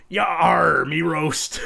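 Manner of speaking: gruff pirate growl. Acted. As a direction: hold up the meat like a pirate. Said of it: "have a pirate".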